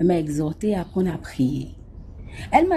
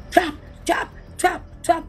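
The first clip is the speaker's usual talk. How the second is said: imitated footsteps; 8 semitones higher than usual.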